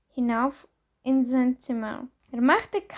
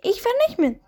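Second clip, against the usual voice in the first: much higher and a bit louder, stubborn; insistent repeat; 10 semitones higher, 4 dB louder, faster than usual. Acted. Ben repeats his refusal to his parents, much higher and insistent. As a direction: dig in and insist.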